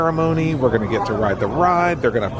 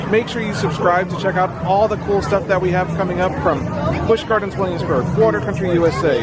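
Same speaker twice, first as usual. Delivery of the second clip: higher, bright sign-off voice; upbeat outro; 6 semitones higher than usual. Acted. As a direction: wrap it up like a YouTube host.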